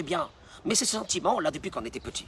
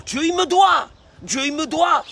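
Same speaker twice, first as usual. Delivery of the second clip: louder and higher, shouting God owes me; indignant shout; 10 semitones higher, 9 dB louder than usual. Acted. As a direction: hurl the demand at God. Sums up the complainer's demand.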